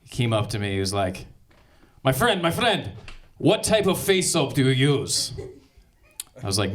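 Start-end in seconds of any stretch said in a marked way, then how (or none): 2.0-5.3 put-on Russian accent. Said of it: "Soviet type accent".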